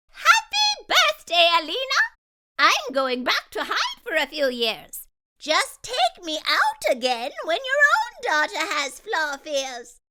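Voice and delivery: very high, loud joyful fairy; excited fairy. Acted. Fairy wishing Alina happy birthday, very high and excited. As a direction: burst out a cheerful farewell as a fairy.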